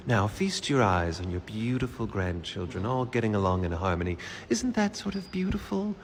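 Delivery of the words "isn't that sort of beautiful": mock tender. Improv scene, grandparent showing family.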